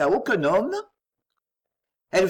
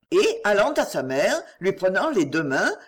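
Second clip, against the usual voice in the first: usual speaking voice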